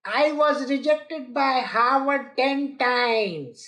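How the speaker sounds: slow, lightly voiced boasting conference speaker; a hint of humble-brag drawn out; mock a speaker milking his own rejections; self-promoting speaker. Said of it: "a person who talks like this".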